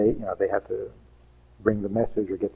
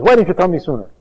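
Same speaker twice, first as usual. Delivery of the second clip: higher, louder indignant demand; indignant husband; 7 semitones higher, 8 dB louder, faster than usual. Retold or imitated. Husband to wife, louder indignant reproach. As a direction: hammer the why like an accusing husband.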